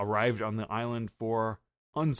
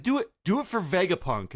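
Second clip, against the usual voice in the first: urging Bonnie; 8 semitones higher, 5 dB louder than usual. Retold or imitated. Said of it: "She's like".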